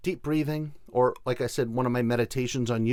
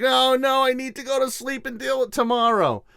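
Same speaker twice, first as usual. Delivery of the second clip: high, loud mock whine of dread; mock dread at bedtime; groaning dread; 10 semitones higher, 4 dB louder than usual. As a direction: whine it like a tired sleeper dreading tomorrow. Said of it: "we're like".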